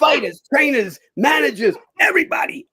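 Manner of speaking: high, loud shouted list; shouted order, rising to the last word; retold shout. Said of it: "he goes".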